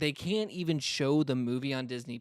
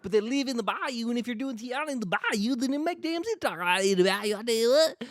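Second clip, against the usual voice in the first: put-on thick Southern accent; 10 semitones higher, 7 dB louder than usual. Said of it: "there's still people who talk like that".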